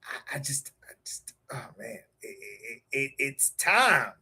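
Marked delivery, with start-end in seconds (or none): 0.0-2.8 whisper. Real, not acted. Overwhelmed before the show.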